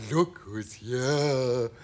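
very slow, drawn-out creature greeting; eerily slow character voice; strange creature voice. A narrator's reading. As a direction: stretch each word out like a strange creature. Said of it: "shocked to hear a voice say".

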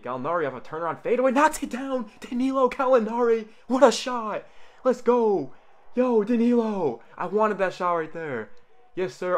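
high, loud, thrilled yelling; excited shouting. Real, not acted. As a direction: cheer the shot like a fan. Live reaction to a big shot.

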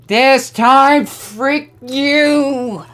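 loud, high yell of defiance; defiant shout. Real, not acted. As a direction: scream it at the level. Shouting at game on retry.

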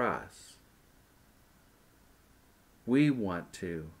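slow, hushed prayer with long pauses. Acted. Pastor leading kids in a repeat-after-me prayer, slow and hushed. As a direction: pray it gently, phrase by phrase, for the children to repeat.

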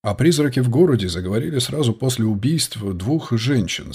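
ordinary delivery

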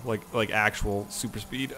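normal talking voice

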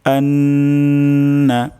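আন্না with a long held nasal ন, drawn out and sung; nasal ghunnah. Shown as a demonstration. Teacher shows ghunnah to students, long held nasal. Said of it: "এখানে গুণনা হবে".

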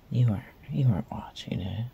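ordinary talk

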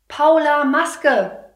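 loud, high call across the room. Retold or imitated. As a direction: call the child back sharply. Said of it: "rufe ich". (laut hinterherrufen)

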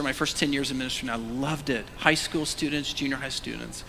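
ordinary delivery

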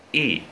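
Eat as a long "ee", then a separate released T; two-breath ending sound. Shown as a demonstration. Teacher shows students the ending sound, Eat in two breaths. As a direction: say Eat in two breaths, vowel first, crisp T after. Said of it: "các em đọc thành hai hơi".

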